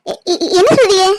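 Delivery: stammering in disbelief; shocked stammer. Acted. Bride stunned by groom's reply, stammering in shock. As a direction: caught off guard, words stumbling out.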